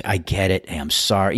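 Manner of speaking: ordinary delivery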